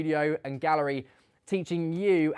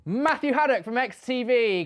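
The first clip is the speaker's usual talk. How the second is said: high, loud winner reveal; excited announcement; 9 semitones higher, 6 dB louder than usual. Real, not acted. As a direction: proclaim the winner brightly.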